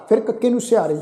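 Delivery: ordinary talk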